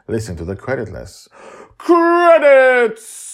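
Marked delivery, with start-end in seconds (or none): 1.8-3.4 high, loud blurted word; host ending with a loud comic pop; sudden shout. Shown as a demonstration. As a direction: burst out on the last word. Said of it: "comic loud punchline".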